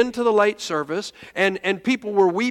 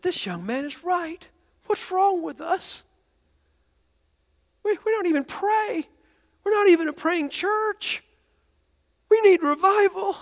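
retold anguished plea, pitched way up; imitating tearful older woman; 11 semitones higher than usual. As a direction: plead in a high voice on the edge of weeping. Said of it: "And she began to weep".

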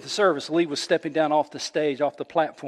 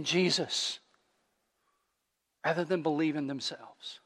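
earnest prayer; 2 semitones higher than usual. Acted. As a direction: plead with God on their behalf. Pastor prays aloud to God.

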